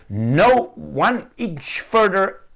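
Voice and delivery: slow promise. Retold or imitated. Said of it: "And said".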